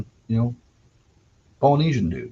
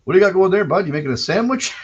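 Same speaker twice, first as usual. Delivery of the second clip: put-on higher, louder gleeful dad with a bit of prairie accent; dad impression, prairie accent; 8 semitones higher, 6 dB louder, faster than usual. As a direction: tease the kid like a jolly prairie father. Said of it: "I can give you a pretty good impression".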